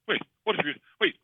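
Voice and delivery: high, hurried, flustered questions; panicked. Retold or imitated. Father retelling his freak-out at the dentist's office, panicked. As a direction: rattle it off in a comic panic.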